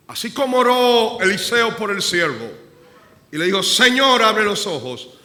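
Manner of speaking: loud sermon climax; fervent preaching. Real, not acted. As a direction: preach it out with full force. Preaching at full force.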